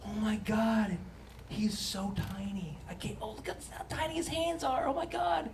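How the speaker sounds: soft, cooing over a newborn; a hint of a gushing new mother; cooing parent. New parent cooing over a tiny baby, soft and gushing.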